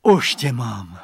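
joyful. Acted. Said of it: "zaradoval se".

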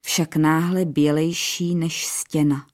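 plain reading voice

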